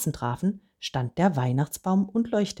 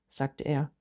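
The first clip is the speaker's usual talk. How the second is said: ordinary delivery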